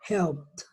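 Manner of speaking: "helped" as one syllable, helpt. Shown as a demonstration. Teacher repeating the model, T ending. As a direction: close "helped" with pt, no vowel. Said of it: "voy a pronunciar una T".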